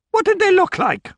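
high-pitched questioning gamekeeper; gamekeeper's character voice. Acted.

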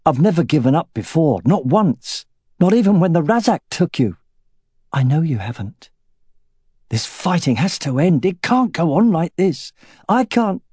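rising, strained and pleading; desperate outburst. Acted. Husband pouring out despair to his wife, strained. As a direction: voice climbing as he fights tears.

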